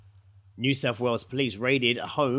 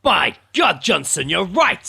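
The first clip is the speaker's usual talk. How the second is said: loud, higher hammed-up boss voice, slow; mock police chief; 5 semitones higher, 10 dB louder, slower than usual. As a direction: bellow it like a TV police captain. Acted police chief scene.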